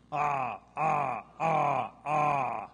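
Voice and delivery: short loud open-throated moans; zombie bark. Shown as a demonstration. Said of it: "And from zombies".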